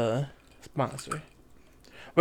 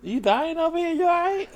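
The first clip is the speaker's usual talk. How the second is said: surprised concern; 12 semitones higher than usual. Real, not acted. Friend choking on drink.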